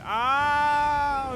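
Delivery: sudden high piercing scream; shrill scream. Acted. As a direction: let out a loud piercing shriek. Demanded to show the scream.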